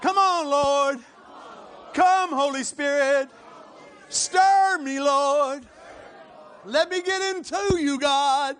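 very loud, high invocation; drawn-out cries to God; crying out in prayer. Real, not acted. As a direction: plead for the Spirit at full voice.

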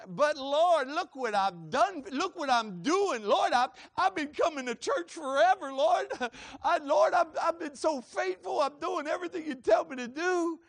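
loud, high-pitched mock protest; hammed-up wail of self-justification; complaining to God. Faithful churchgoer pleads his record to the Lord, loud mock complaint.

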